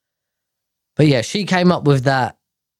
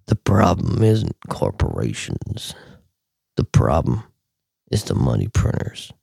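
tweeting alter ego voice; 12 semitones lower, 4 dB quieter than usual. Shown as a demonstration. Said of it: "I actually did it in this voice".

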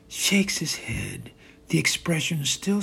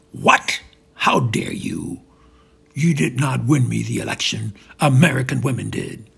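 explosive anger; 5 dB louder than usual. Acted. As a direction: punch it out in outrage. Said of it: "Trump explodes with anger".

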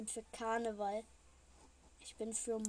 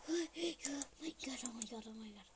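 scared whisper; 4 dB quieter, faster than usual. Real, not acted. Watching others get caught.

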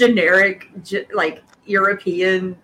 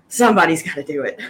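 put-on exasperated older guy; 5 semitones lower, faster than usual. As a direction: play the weary hero giving in. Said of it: "the exasperated older guy that's just like".

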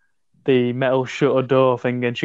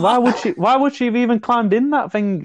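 higher, indignant disbelief; heated protest; 10 semitones higher than usual. Real, not acted. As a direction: push back hard at your friends. Heated film argument with friends.

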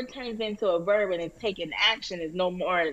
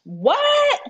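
sudden high squeak of disbelief; a yelp of shock, much higher and louder; shocked what; 6 dB louder than usual. Real, not acted. Shocked at birthday gift.

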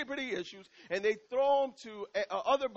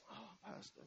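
mock sigh; 25 dB quieter than usual. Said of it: "He's like".

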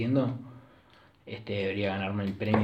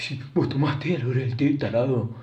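loud, higher-pitched mocking imitation; mocking Juancho; 3 semitones higher, 6 dB louder than usual. Friend jeering at him about tea time, mocking imitation. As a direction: mimic a friend jeering, pushed up and louder. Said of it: "el mogólico de Juancho que la otra vez me decía".

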